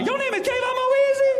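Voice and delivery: extremely high squealed question. Retold or imitated. TSA worker hearing the full name, very high squeal. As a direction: squeal it at the top of your range. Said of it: "His voice went up even higher".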